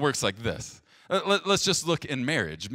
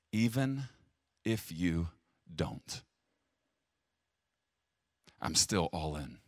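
quiet prayer of trust; 6 semitones lower, 6 dB quieter than usual. Shown as a demonstration. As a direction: pray it quietly, fully committed. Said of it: "A covenant says".

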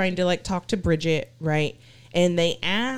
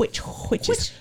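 ghostly whisper; 3 dB quieter, slower than usual. Retold or imitated. Imitating the EVP voice.

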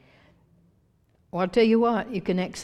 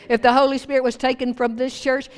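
usual speaking voice

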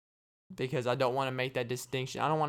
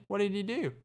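higher-pitched, eager question; acted curiosity, pitched up; curious gossip; 7 semitones higher than usual. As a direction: play an intrigued listener asking for the gossip. Acted gossip exchange about Craig.

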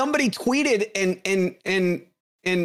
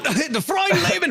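mock German shout; 10 semitones higher than usual.

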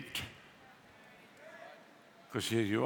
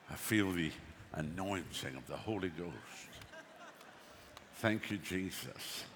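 low, soft, devout preaching; hushed reverent preaching; 7 semitones lower, 5 dB quieter than usual. Real, not acted. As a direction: lower your voice in awe. Recounting the anointing in a hushed voice.

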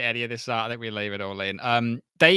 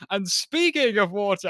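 mock suave delivery, pitched up; imitating the line; 8 semitones higher than usual. Podcaster quoting the show to cohost, mock suave. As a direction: echo how the actor says it. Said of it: "The way he says that".

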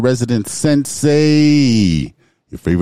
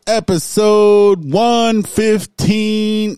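slow, high, stretched-out holler; yelled announcement; 7 semitones higher, much slower than usual. Pretend. Hosts announcing episode one fifteen, long playful yelling. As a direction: holler the episode title, drawing out each word. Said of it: "There's a lot of yelling around there".

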